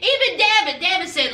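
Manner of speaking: ordinary talk, nothing marked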